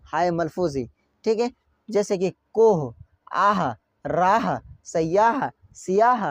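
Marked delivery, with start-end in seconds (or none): 2.5-6.2 कोह, आह, राह, सयाह, सियाह with an audible breathed h; sounded final h. Shown as a demonstration. Teacher giving learners examples, final h voiced out. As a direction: let the h be heard ending कोह and राह. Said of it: "इस तरह से हम उसकी आवाज़ निकालते हैं".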